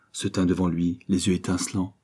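plain reading voice

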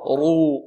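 drawn-out emphatic رو; thick rolled r with long oo on رو; heavy ru. Shown as a demonstration. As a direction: model رو heavy, for the class to repeat. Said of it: "المضمومة ماذا؟".

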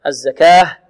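الزكاة with a short two-beat final aa; two-count stop. Shown as a demonstration. Teacher showing the shortest pausal length, brief vowel. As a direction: end الزكاة briefly on a clear h. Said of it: "اثنان".

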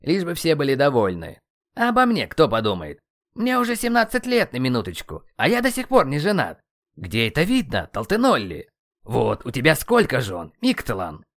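worked up, pitch leaping in protest; indignant rant. A narrator's reading. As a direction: wail about how unfair life is. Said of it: "попросил негодующего воина".

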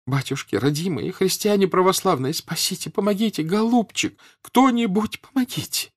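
high, wailing cry for help through sobs; sobbing plea. A narrator's reading. Desperate mother begs Pierre for help, sobbing. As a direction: wail it out, begging between sobs. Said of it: "выговаривала она сквозь рыдания".

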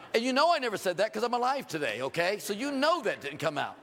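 normal talking voice

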